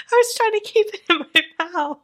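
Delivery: high helpless laughter after a spit take; laughing to tears. Real, not acted. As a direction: crack up until you cry. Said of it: "I'm laughing so hard I'm crying".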